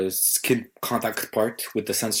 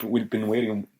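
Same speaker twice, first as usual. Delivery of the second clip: ordinary talk, nothing marked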